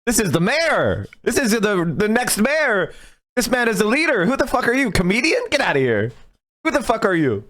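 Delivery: lightly voiced imam defending his mayor; imitating the indignant imam. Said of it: "It's the imam, you know, being like".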